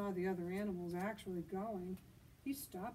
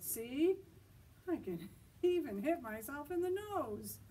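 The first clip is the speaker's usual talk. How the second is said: higher and louder, laughing through it; proud boast; 7 semitones higher, 6 dB louder than usual. Acted. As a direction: brag with a laugh. Dexter boasting with teary eyes.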